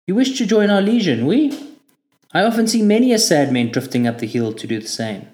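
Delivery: chatty local Frenchman teasing the recruit; a hint of a French stranger's voice; French driver.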